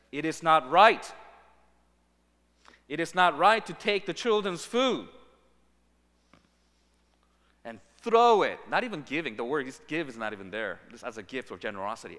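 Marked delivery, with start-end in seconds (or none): 0.1-8.6 loud, firm refusal, hitting right and throw; stern refusal. Acted. Jesus refusing the begging woman, stern and loud. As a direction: slam the door on her plea. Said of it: "he turns to her and says".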